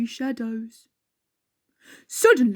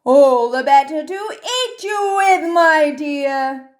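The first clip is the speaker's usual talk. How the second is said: louder, rising wolf threat; menacing wolf; 4 semitones higher, 4 dB louder than usual. Acted. Wolf about to pounce on the girl, loud and menacing. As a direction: build up to the pounce. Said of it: "said the wolf".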